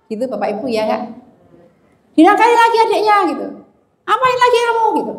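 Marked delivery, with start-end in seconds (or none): 2.2-4.9 high, loud, fast scolding shout; angry scolding. Retold or imitated. Mother scolding eldest for bullying sisters, fast high shout. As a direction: snap at the child, fed up. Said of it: "saya akan berteriak".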